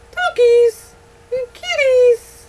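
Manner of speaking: high, loud, slow sing-song; excited sing-song. Real, not acted. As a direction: stretch it out with delight. Excited baby talk.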